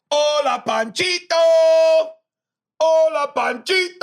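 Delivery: high, loud holler with long held endings; drawn-out shouted greeting. Pretend. Host hollering a greeting to Panchito, long high playful shout. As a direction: belt out the name, stretching the last vowel.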